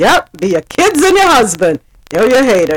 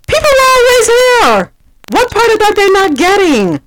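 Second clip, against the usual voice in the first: exasperated high voice; 9 semitones higher than usual. Pretend. Mock voice of exasperated response.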